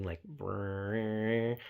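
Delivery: a playful imitated electric hum; imitating a hum. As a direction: buzz it like a charging machine. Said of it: "you can hear the hum getting".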